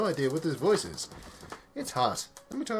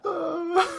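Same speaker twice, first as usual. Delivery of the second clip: tearful sob; 8 dB louder than usual. Real, not acted. Overcome, crying.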